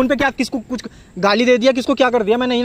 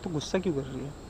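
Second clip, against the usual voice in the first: soft pleading; 6 semitones lower, 16 dB quieter than usual. Acted. Acted quarrel, boyfriend pleading.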